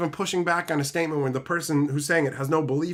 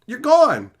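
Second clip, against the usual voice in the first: curt dismissal; 5 semitones higher, 8 dB louder than usual. Retold or imitated. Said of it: "you can just go".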